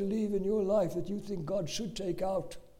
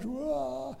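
high mock wail "wah"; imitated child's wail; 7 semitones higher, 4 dB quieter than usual. Boy with a nail through his foot, mock wailing. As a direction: let out a childish cry. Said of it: "screaming and crying".

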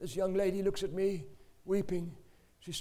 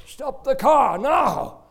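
slow, loud, high commanding shout; 8 semitones higher, 15 dB louder, much slower than usual. Retold or imitated. God ordering him to stop the car, loud slow shout.

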